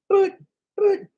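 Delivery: squeaky high boop-boop wheel ticking sound; wheel clicks. Pretend. Imitating the Price is Right wheel, high squeaky clicks. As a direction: mimic the wheel clicking past the pegs.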